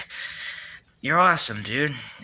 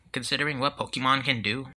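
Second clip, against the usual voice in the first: ordinary talk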